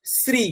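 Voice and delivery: শ্রী with the tongue at the teeth, a crisp s-ri; dental s. Shown as a demonstration. Teacher demonstrating the name for the class. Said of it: "জিভটা ওই দাঁতের জয়েন্টে লাগবে".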